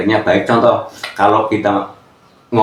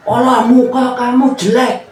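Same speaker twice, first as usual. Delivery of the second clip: mock insult; 11 semitones higher than usual.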